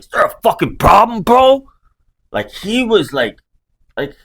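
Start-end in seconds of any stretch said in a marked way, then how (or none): 0.0-1.7 high, loud mock tough-guy challenge; hammed-up bar-fight challenge, ready to swing; tough guard challenge. First guard walking up on him, loud high mock tough challenge. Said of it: "that's how you walk up on somebody".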